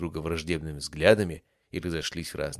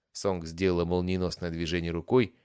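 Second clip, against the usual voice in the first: plain reading voice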